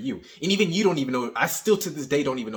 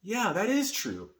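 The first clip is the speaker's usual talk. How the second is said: put-on girl agreeing; 7 semitones higher, 4 dB quieter, slower than usual. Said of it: "So she's gonna be like".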